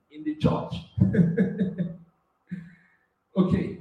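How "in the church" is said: usual speaking voice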